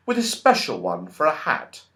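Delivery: ordinary delivery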